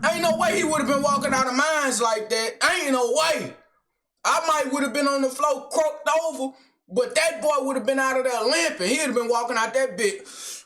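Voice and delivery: high-pitched, emphatic insistence; adamant protest. Real, not acted. Viewer insisting the attacker would have limped out, adamant. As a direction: protest it heatedly, not having it.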